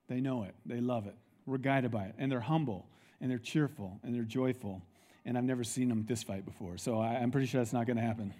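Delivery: soft assurance. Acted. Preacher praising his deacons softly.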